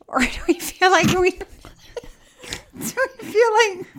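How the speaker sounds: giggling through the words; breaking up mid-sentence; laughing. Real, not acted.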